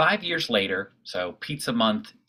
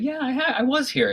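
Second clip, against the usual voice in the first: nervous reply; 13 semitones higher than usual. Retold or imitated. His own nervous reply retold.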